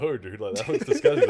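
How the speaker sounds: disgusted, laughing through it; cracking up while refusing. Retold or imitated. Retelling own disgusted reply.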